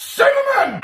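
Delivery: very high squeal; shrieked. Acted. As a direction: shriek it like a cartoon. Shrieking ingredient name.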